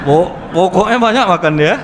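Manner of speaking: words broken up by real laughter; laughing. Real, not acted. Preacher laughs while telling the congregation how much he ate. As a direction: chuckle through the punchline.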